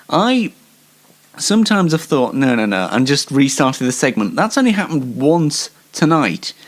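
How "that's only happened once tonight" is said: normal talking voice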